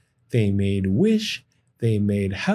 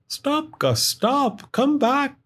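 high, urgent call; 13 semitones higher, slower than usual. Acted. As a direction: call after him urgently. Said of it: "called Sam".

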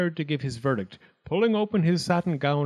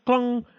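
high, ringing bell clangs; imitated bell; 10 semitones higher, 4 dB louder than usual. A narrator's reading. Coachman rings his bell, high clanging imitation. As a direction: ring it out like a handbell.

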